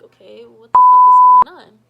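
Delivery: high, loud panicked scream; shocked yell. Retold or imitated. Finding Sandy in her bed, high panicked scream. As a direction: scream the question in disbelief.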